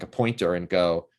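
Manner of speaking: ordinary talk, nothing marked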